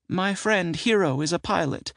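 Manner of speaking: high aunt voice, Hero said trustingly; proud of her friend Hero; woman voice. Acted. Sybil voiced, narrator then echoes.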